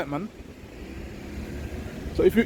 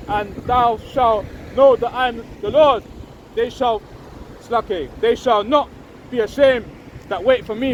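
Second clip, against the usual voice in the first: slow, raised solemn Bible reading; measured, elevated reading of scripture; 3 semitones higher, slower than usual. Acted. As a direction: proclaim the verse slowly like a preacher. Reads Isaiah aloud.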